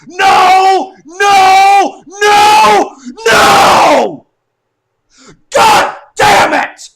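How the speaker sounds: high, very loud screams, drawn out; real anguished yelling. Real, not acted. Fan upset his team lost, screaming in frustration. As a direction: scream each word in despair at the loss. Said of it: "I'm, I'm yelling".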